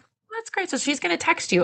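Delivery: high, sing-song polite reply; mock cheerful reply. Doula imitates her own cheerful reply, mock polite. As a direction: play it brightly polite. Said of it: "I'm like".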